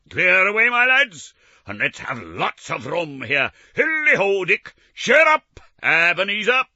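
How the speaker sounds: loud, high hearty call; jovial voice. Acted. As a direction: call out like a jolly old boss.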